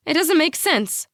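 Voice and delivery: high, loud disbelieving protest; high-pitched blurt. A narrator's reading. Mom confronts dad, high-pitched blurt. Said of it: "her words tangled into one high pitch".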